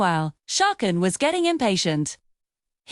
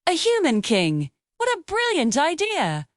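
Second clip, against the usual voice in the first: rising, giddy monkey cheer; excited monkeys; 6 semitones higher than usual. A narrator's reading. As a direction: shriek it with glee, climbing high. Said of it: "The monkeys shrieked with excitement".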